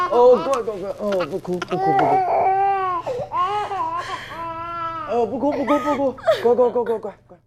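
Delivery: gentle cooing. Acted. Cooing to calm someone crying.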